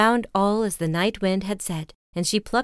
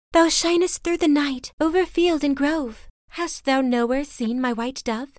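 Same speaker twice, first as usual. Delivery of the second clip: much higher, imploring heroine; Lily, higher pleading; 7 semitones higher than usual. A narrator's reading. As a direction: cry out to the moon.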